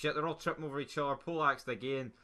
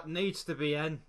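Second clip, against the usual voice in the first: ordinary talk, nothing marked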